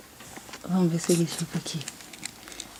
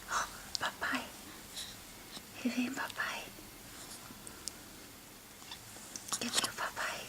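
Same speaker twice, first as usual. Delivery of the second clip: whisper; 3 semitones higher, 20 dB quieter than usual. Real, not acted. Whisper so dad hides.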